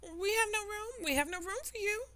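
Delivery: high, squeaky mad cry; shrill hosts shooing her off. Acted. The Hare and Hatter cry out at Alice, high and excited. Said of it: "they cried".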